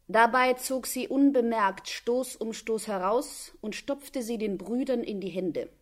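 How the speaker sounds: normal talking voice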